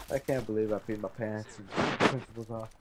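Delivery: normal talking voice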